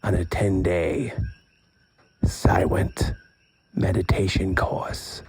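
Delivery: slow, measured reading aloud; book blurb read. Acted. Host reads friend's back cover to listeners, slow blurb read. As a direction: read it out slowly, phrase by phrase.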